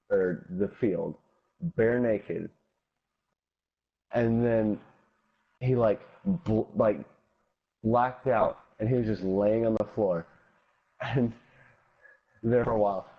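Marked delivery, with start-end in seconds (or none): none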